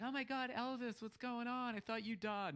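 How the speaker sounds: high, mock starstruck squeal; gushing fans. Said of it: "Everybody's like".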